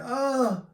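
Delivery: imitating a high pained grunt of a stabbed man. Stabbed man grunts as he's hit, high gasp. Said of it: "dude's like".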